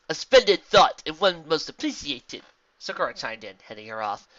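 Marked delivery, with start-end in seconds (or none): 0.1-2.4 loud, higher, measured zebra voice; zebra character. Acted. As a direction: speak as a gracious zebra, projecting. Voicing zebra character in fiction.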